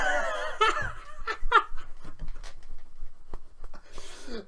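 high breathless shrieking laugh; ecstatic laughing scream. Real, not acted. Celebrating the goal, shrieking with laughter. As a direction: let out a wild whooping laugh.

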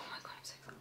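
hushed, breathless whisper; excited whisper. Real, not acted. Vlogger whispering her excitement to viewers. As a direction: whisper it like a secret thrill. Thrilled about the new perfume.

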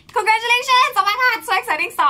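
high, loud, thrilled congratulations; excited cheer. Real, not acted. Vlogger cheering Joseph's Olympic gold, loud and thrilled. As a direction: burst out cheering for the gold medal.

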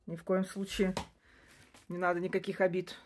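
ordinary delivery

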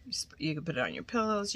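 quick breathy whisper aside. Real, not acted. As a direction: rush it out in a whisper. Whispered aside while unboxing.

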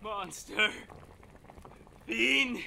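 "Monster." barely voiced, rising in dread; hushed horror. Horrified man faces alien captor.

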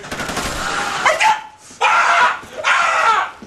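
very high screams of rage; frustrated screams. Real, not acted. Gamer who injured someone without a kill, frustrated screams.

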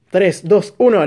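usual speaking voice

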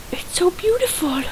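whispered in awe; awed whisper. Real, not acted. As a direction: breathe it out in wonder. Admiring a pile of TNT.

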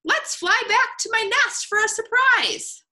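high, excited bird voice; gleeful high-pitched invitation; cheerful. A narrator's reading. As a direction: announce it high and happily to the friends. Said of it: "forgotten all about being grumpy".

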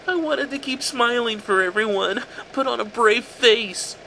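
put-on high, strange, sing-song little boy voice; eerie child-like voice. A narrator's reading. A disfigured son explaining himself to his mother, high and eerie. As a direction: sound creepily innocent. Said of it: "he started".

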